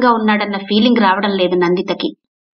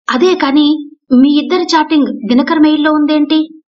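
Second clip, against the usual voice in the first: higher, louder astonished question; 6 semitones higher, 3 dB louder than usual. A narrator's reading. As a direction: friend baffled by the mail. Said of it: "అంది ఆశ్చర్యపోతూ సీమ". (ఆశ్చర్యంగా అడిగే గొంతు)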